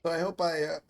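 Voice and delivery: talking with hiccups; speech broken by a hiccup. Real, not acted. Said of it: "got the hiccups".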